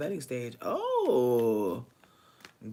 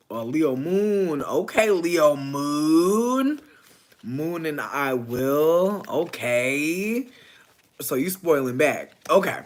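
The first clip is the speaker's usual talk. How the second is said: teasing; 2 semitones higher, 9 dB louder, much slower than usual. Real, not acted. Teasing over a client's Leo Moon.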